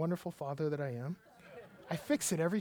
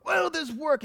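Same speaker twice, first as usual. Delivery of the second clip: put-on frustrated child; 9 semitones higher, 5 dB louder than usual. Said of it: "screaming at the top of her lungs and crying".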